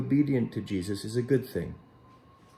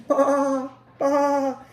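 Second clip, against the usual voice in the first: high, thin bleating baa; plaintive sheep call; 8 dB louder than usual. Acted. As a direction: bleat like a little lost sheep. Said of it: "came faintly from the mountain".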